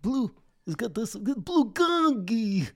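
squeaky cartoon character with a high voice. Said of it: "How many voices can you do?".

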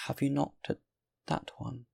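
high, measured question with pauses; suggestive old woman. A narrator's reading. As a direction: begin high, hush on the last words. Old woman points out a house.

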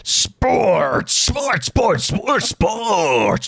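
playful announcer voice; sing-song announcer. Acted. Announcing sports segment.

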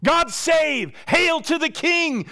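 high, loud excited chant; gleeful shouted acclaim; children's praise. Retold or imitated. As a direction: cheer it like children in a crowd. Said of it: "The kids begin to parrot it".

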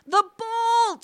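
very high, drawn-out cry; strained shriek. A narrator's reading. Wife struggles with the door, shrieking. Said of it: "she shrieked".

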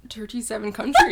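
amused attempt at an Irish accent. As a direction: try a playful Irish lilt. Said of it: "what do Irish people say?".

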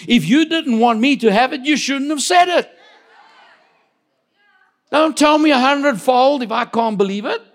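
loud, high, rising sermon cry; thundering pulpit proclamation; full-force preaching. Acted. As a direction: preach it out, building with conviction.

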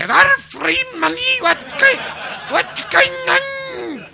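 high mock-Scottish squeal, dragged out slow; mock Scottish person. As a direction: play a dim, delighted Scot grabbing free money. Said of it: "all they'd say".

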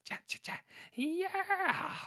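whispered cha cha then a high sung yeah; hummed outro jingle; theme sting. Retold or imitated. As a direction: mimic the show's closing sting. Imitating show's ending sting.

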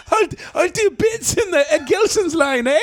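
lightly put-on Scottish accent, high and laughing; a hint of Scottish, giggling through it; half-laughing mock Scottish lilt. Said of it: "annoy people with my accent".

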